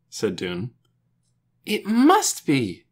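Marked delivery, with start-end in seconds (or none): 1.6-2.8 high, slow, emphatic exclamation; insistent cry. A narrator's reading. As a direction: cry it out with total conviction. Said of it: "Lena cried".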